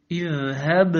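"اذهب" with firm, bounced final b; clear qalqala. Shown as a demonstration. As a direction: echo the last b of "اذهب". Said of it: "فجيء بقلقلتها واضحة". (قلقلة باء "اذهب" واضحة)

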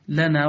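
"لنا" with thin l and front vowels; light lam. Shown as a demonstration. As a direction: keep "لنا" light throughout. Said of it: "فرقق هذه الأحرف". (ترقيق "لنا")

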